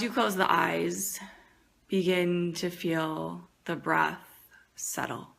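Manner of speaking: soft, unhurried meditation voice with long pauses; guided meditation. Acted. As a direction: ease them gently into the breath.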